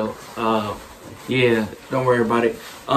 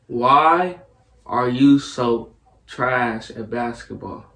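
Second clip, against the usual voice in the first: slow, with a long pause after the first word; slow delivery; much slower than usual. Real, not acted. Player rephrases basketball jab for guesser, slowly. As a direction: space the words out for a lip-reader.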